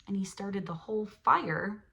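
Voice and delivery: ordinary delivery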